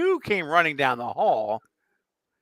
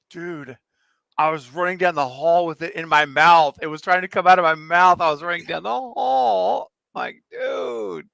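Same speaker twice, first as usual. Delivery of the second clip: louder mock-panicked account, climbing in pitch; friend's exclaimed retelling; 4 semitones higher, 5 dB louder than usual. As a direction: imitate a worked-up friend, rising to a high finish. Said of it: "And you're like".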